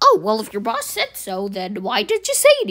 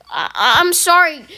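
higher, louder, slow crying sorry; tearful apology; 5 semitones higher, 6 dB louder than usual. Acted. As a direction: choke out the apology.

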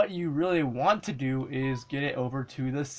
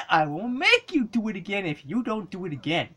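ordinary talk

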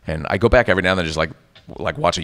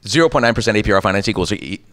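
fast legal disclaimer read; all in one breath; faster than usual. Shown as a demonstration. Voice actor tries the ad's legal copy, in one breath. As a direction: rattle off the fine print. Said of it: "all in one breath".

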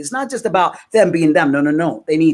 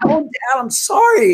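put-on high-pitched little-girl plea to Dad; playful mock apology; 8 semitones higher, slower than usual. Retelling her answer to God as Dad, mock-apologetic. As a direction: whine an exaggerated sorry, pitched way up.

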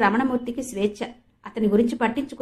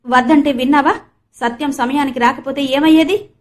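exasperated reproach, a touch louder; irritated; 4 semitones higher, 6 dB louder than usual. A narrator's reading. Brother scolding his sister after the scare, irritated. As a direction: scold her for not listening. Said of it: "అన్నాడు విసుగ్గా".

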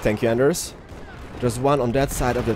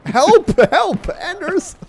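high, loud mock-panicked calls; playful cry for help; 5 dB louder, much slower than usual. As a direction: shout for rescue in a squeal. Calling teammate for help in game.

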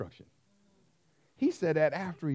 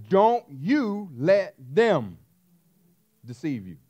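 loud, slow and drawn out; slow emphatic warning; 3 semitones higher, 8 dB louder, much slower than usual. Acted. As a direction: lean hard on every word. Preacher repeating the warning louder.

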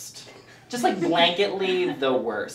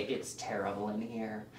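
low, soft lament; hushed, hammed-up despair; mock prisoner misery; 6 semitones lower, 14 dB quieter than usual. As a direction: confide your misery down a prison phone. Acted prisoner on visiting phone.

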